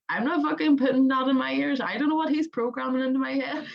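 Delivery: half-hearted mock indignation; imitating partner's scoff. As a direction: lightly voice a partner who refuses. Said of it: "my partner, he was like".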